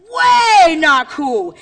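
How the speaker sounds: drawn-out catchphrase. Shown as a demonstration. Speaker leads teachers in the catchphrase, loud and stretched. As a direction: call it out slowly like a crowd chant.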